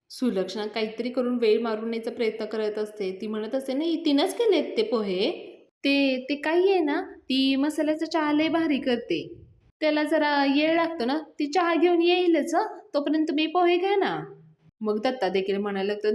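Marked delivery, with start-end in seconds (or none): 3.7-14.1 high, loud, rushed excuses; nervous stalling. Retold or imitated. Sulakshana to the guests, stalling for time: nervous loud excuses. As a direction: talk fast to cover up.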